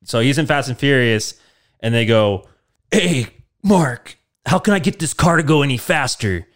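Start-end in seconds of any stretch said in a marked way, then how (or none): 2.9-6.4 a lightly higher put-on racer voice; mock-eager racer pitching up his question; racer asking Mark. Racer asks Mark how to go faster, light put-on voice.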